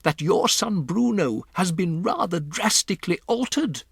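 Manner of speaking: put-on a composed old grandmother breaking odd news; grandmother's voice. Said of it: "my grandmother said".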